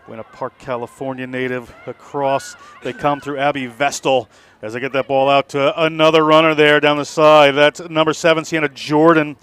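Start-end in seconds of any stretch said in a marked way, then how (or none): 2.8-9.4 excited match call, louder; live play-by-play. Real, not acted. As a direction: call the run down the wing.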